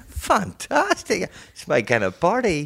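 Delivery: high, very slow fantastic; a farmer thrilled by the offer; delighted farmer. Acted. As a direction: stretch it out with glee.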